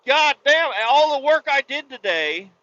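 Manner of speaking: loud, high-pitched outburst; angry cussing. Retold or imitated. Driver cusses alone as the cop walks away, angry. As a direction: curse loudly in retold frustration, voice way up. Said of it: "I'm in there fucking cussing".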